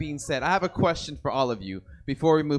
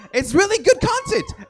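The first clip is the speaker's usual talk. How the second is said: much higher, loud bragging; excited boast; 9 dB louder than usual. Real, not acted. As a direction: brag proudly. Proud self-praise.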